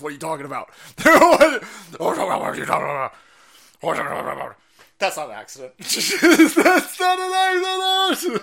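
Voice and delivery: words stretched out through laughter; laughing. Real, not acted. Streamer cracking up at the clip, laughing.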